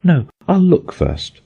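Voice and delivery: put-on high, light girlish voice; a young girl checking carefully; Alice voice. A narrator's reading. Alice to herself, high and careful.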